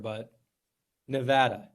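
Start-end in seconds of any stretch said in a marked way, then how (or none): 1.1-1.7 Nevada carefully said as nuh-VAD-uh; short A in the middle of Nevada; corrected pronunciation. Shown as a demonstration. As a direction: say Nevada the local way, rhyming with "had". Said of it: "There you go".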